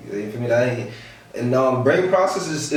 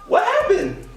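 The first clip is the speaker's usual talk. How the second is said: high laughing surprise; surprised; slower than usual. Retold or imitated. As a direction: ask it half laughing, thrown off. Opening car door to kids.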